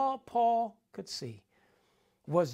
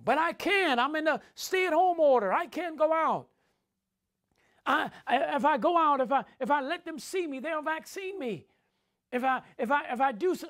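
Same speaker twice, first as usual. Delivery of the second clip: higher, pleading excuse voice; mock whining excuse, pitched up; whiny fearful believer; 5 semitones higher than usual. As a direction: play the scared churchgoer making excuses.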